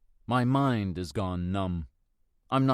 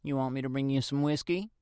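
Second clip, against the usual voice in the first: higher, lightly voiced neighbour; older woman offering; 5 semitones higher than usual. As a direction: kindly offer a drink.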